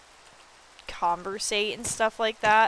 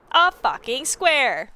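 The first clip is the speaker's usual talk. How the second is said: louder, slow, fed-up answer; exasperated; 4 semitones higher, 6 dB louder, much slower than usual. Acted. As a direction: spell it out in exasperation. Exasperated answer to own quiz.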